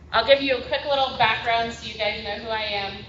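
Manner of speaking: ordinary talk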